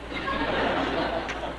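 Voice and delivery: a quiet breathy chuckle under the breath; gentle amused laugh; soft laugh. Real, not acted. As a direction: let a soft laugh slip out. Laughs after wry aside.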